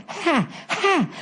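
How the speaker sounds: high, sharp hot-mouth gasps; burned-mouth panting. Retold or imitated. Friend bit hot cheese, high gasping pants. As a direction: imitate a friend huffing at scalding cheese. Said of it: "after he burned his fucking mouth".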